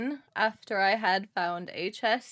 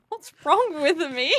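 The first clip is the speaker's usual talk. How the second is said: panicked; 9 semitones higher, 10 dB louder than usual. Real, not acted. Panicked gasp over game mistake.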